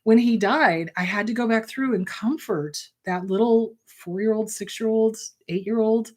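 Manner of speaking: ordinary talk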